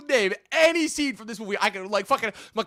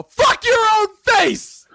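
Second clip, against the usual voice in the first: much higher, louder, slow shout; mock shouted insult; 11 semitones higher, 7 dB louder, much slower than usual. Retelling Tom Cruise's character screaming insults. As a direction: bellow each word slowly.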